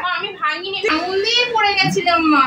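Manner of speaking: high, loud desperate plea; pleading. Acted. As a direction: beg mother to believe you. Beaten girl pleads with mother. (মায়ের কাছে কাতর মিনতি)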